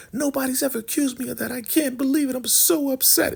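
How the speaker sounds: very high falsetto fake distress; mock tearful-sounding protest without real tears; hammed-up upset. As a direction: overplay the wronged victim, squeaky high. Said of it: "she's really playing the role".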